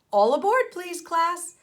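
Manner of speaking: loud, high boarding call; teacher calling the class onto the bus. Acted. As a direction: call everyone aboard.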